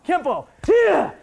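loud, high, all-out shout of pride; battle shout. Real, not acted. Instructor to students, a loud war cry.

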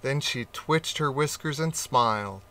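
usual speaking voice

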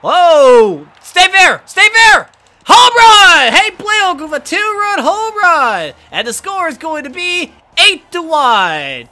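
high, loud pleading then triumphant call; will the ball fair, then erupt; frantic excited shouting. Real, not acted. Gamer begging the ball to stay fair, then shouting in triumph.

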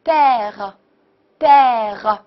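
"père" very slowly with open eh; pronunciation demo. Shown as a demonstration. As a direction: model "père", drawn out, French r at the end. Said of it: "所以读作".

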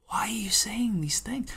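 hushed baffled whisper; whispered aside. Real, not acted. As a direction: whisper your disbelief. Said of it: "baffled aside".

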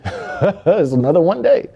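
laughing through the words, stretched out; amused laughter. Real, not acted. Storyteller catching his own repeated phrase, amused laughter. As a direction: chuckle as you drag it out.

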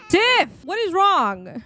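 high, drawn-out exasperated call; playfully exasperated parent; mock exasperation. Calling to a crying sim.

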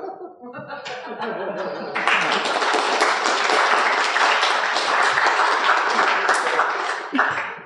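airy breathy laugh. Real, not acted. Laughs at own joke.

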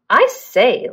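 ordinary delivery

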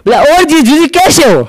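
ordinary talk, nothing marked

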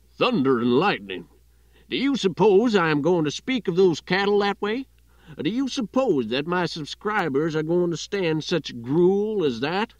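a fiery Southern editor blowing his top; furious shout. Acted. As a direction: bellow it at the new hire in fury. Editor springs up, outraged at article.

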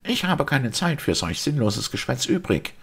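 high-pitched, irritated dismissal; annoyed. A narrator's reading. Simon brushes off the priest's talk, annoyed. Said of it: "bemerkte er ärgerlich".

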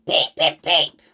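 short, loud peeps; imitated guard whistle. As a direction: imitate a whistle in sharp bursts. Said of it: "That sounds like the guard whistle".

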